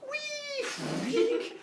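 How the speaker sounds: very high squealed cheer; gleeful leap. Acted. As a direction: squeal it as if leaping off a mountain. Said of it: "when you jump up, you go".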